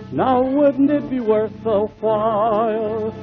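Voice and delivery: ordinary talk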